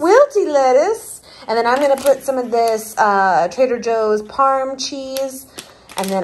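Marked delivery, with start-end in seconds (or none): 0.0-1.2 loud teasing echo; mocking repeat. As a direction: throw the phrase back teasingly. Teasing repeat of partner's words.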